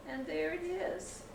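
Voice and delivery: ordinary delivery, nothing marked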